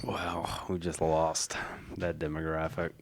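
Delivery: quiet, stunned murmur; hushed aside. Real, not acted. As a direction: say it very softly and low. Hushed aside after an offensive bit.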